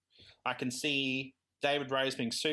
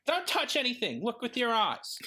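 fussy David; 8 semitones higher than usual. Retold or imitated. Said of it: "he's like".

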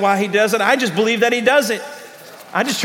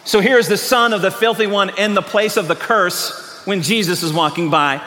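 usual speaking voice

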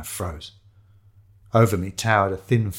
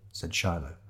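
ordinary delivery, nothing marked

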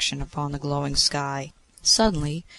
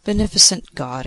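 louder, higher grateful cry; exclaiming; 4 semitones higher, 7 dB louder than usual. A narrator's reading. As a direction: call out to the god with raised voice. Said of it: "exclaimed Ixion".

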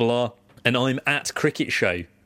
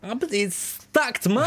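very high, slow mock voice on "stacked, man"; mocking imitation; 13 semitones higher, slower than usual. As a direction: parrot his line back in a silly high drawl. Mimicking co-host's phrase.

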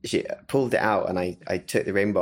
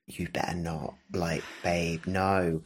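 quiet inner groan; 3 semitones lower, 6 dB quieter, slower than usual. Retold or imitated. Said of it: "I looked at it and I thought".

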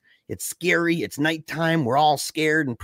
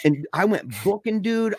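usual speaking voice

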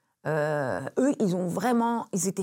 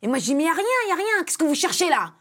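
high, loud heated yelling; mock angry shout in the car; retold shouting; 8 semitones higher, 5 dB louder, faster than usual. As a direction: yell it back and forth in the car.

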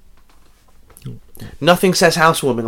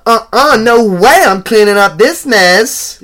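high, loud, hammed-up sass; sassy woman; 9 semitones higher, 7 dB louder, slower than usual. Co-host mocking a cliched Ghostbusters character, loud high sass.

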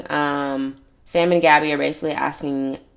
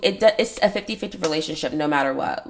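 usual speaking voice

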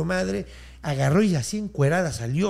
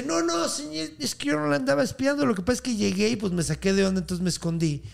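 pleading excuse; 9 semitones higher than usual. Retold or imitated. Julián denying to his boss that he spied, pleading excuse. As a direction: imitate someone caught out, hurriedly explaining.

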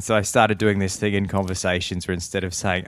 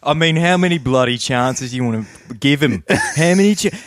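higher, broad Aussie talkback rant; irate footy caller; 9 semitones higher, 4 dB louder than usual. Acted. Caller fed up with the footy club, mock-outraged rant. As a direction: rant like an exasperated footy fan, pitched up.

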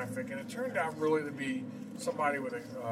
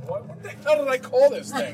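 laughing; 9 semitones higher, 9 dB louder than usual. Real, not acted. Host forgets studio name, laughing.

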